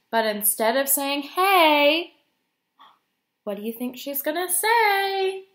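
suspenseful storybook read, slowing at key moments; reading to children. A narrator's reading. Guest reader building to the fish's surprise kiss, dramatic storytime read. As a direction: stretch out the surprise for young listeners.

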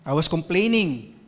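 ordinary talk, nothing marked

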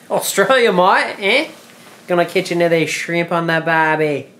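hammed-up Aussie accent, high and loud; lightly put-on Australian accent; mock Australian. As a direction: play a jokey Australian bloke. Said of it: "She's never heard of an Australian".

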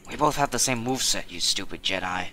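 lightly voiced young-hero impression, badly done; bad Luke Skywalker. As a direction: do a half-hearted film-hero impression. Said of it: "My bad Luke impression".

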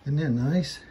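usual speaking voice

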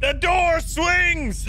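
panicked shout at the door; scared yell. Real, not acted. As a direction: yell it out in fright. Panicked yelling at swinging door.

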